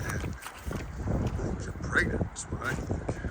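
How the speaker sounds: slow, breathy whisper to the pig. Real, not acted. Owner whispering fondly to her pregnant sow. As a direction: share a secret with the sow.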